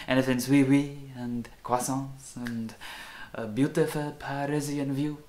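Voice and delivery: put-on light French accent, playful; tossing in a playful hint of French; mock French accent. Light French accent for a Paris trip. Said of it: "carrying on with the French accent".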